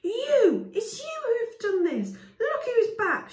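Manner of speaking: high, loud accusing yell. Acted. Villager woman accusing Chaya, angry yell. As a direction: hurl the blame at her. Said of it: "she yelled".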